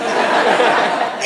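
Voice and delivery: loud, high burst of laughter. Real, not acted. Voice actor laughing at his own anecdote, loud laugh.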